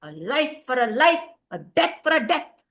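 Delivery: angry refusal. A narrator's reading. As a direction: throw back the offer. Sons refuse money angrily.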